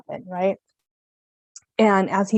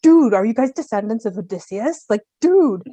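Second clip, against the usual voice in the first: louder, amazed retold excitement; freaking out; 6 dB louder than usual. Learning of husband's Ithacan grandfather, retold freak-out. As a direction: burst out as if starstruck. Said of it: "I'm freaking out".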